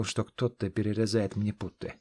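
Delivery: plain reading voice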